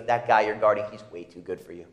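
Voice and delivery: lightly voiced coach, low and hushed; taunting coach. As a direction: needle him like an old coach psyching you up. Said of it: "He goes".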